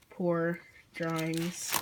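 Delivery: normal talking voice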